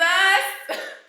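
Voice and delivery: short real laugh at herself; a quick real chuckle; rueful laugh. Real, not acted. Laugh after outburst.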